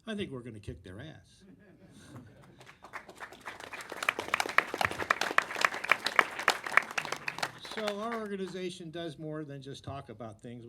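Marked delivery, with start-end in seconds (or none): 1.5-7.7 long loud hearty laugh. Real, not acted. Laughs after joke at panel.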